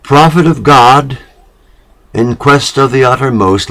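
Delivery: a seeress hailing the prophet with lifted voice; hailing call. A narrator's reading. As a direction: call out to him in reverent greeting. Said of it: "And she hailed him".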